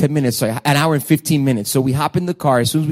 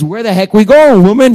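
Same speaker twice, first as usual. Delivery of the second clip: loud, frustrated demand; exasperated shout; 4 semitones higher, 9 dB louder than usual. Retold or imitated. Pastor venting at his wife, loud exasperated shout. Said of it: "all I wanna say is".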